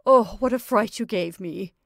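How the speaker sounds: loud exclamation of relief; relieved outburst. A narrator's reading. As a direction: let the fright go out of you. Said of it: "sighed with relief".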